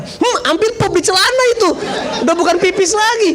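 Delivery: high, overwhelmed comic exclamation; comic astonishment. Retold or imitated. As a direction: blurt it high, as if bowled over. Joking about his overwhelmed astonishment.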